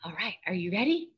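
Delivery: ordinary delivery, nothing marked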